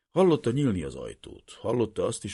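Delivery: ordinary delivery, nothing marked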